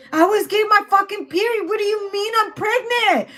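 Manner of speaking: high-pitched outraged disbelief; acted indignant panic; mock meltdown. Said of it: "Someone's having a meltdown".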